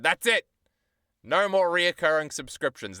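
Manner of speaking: fed-up outburst. Retold or imitated. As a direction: bang the table: enough is enough. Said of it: "and then I said".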